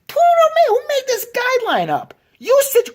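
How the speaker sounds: high, loud furious shout; screaming outrage, voice cracking high; outraged. Real, not acted. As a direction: explode at whoever made the rule. Outraged rant peak.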